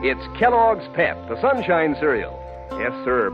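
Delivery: ordinary talk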